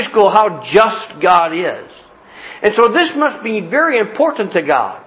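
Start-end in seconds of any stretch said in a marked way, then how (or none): none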